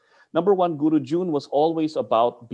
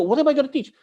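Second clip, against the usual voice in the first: panicked question; 13 semitones higher, 6 dB louder, faster than usual. Retold or imitated.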